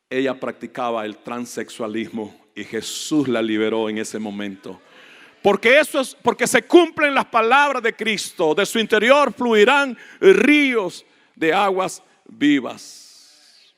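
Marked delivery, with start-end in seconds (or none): 5.4-13.6 loud, high proclamation slowing at the end; impassioned preaching. Real, not acted. Preacher declaring Christ's words fulfilled, impassioned preaching. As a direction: proclaim the scripture, stretching the last words.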